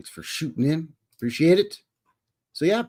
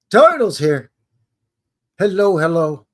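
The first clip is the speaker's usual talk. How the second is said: Turtle shouted high and loud in a put-on accent; playful accent; 5 semitones higher, 8 dB louder than usual. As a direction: call out the name in a funny accent, much higher and louder. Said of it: "you gotta say title that way".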